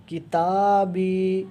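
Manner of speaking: کتابی with long a and i stretched slowly; کتابی drawn out slowly; long vowels drawn out. Shown as a demonstration. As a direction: hold the a and i in کتابی one beat each. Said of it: "تو یہ یا مدہ".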